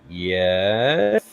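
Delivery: drawn-out, questioning yes; long-suffering answer. Retold or imitated. Answering partner who spammed pet names, long-suffering. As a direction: stretch it slowly up into a question.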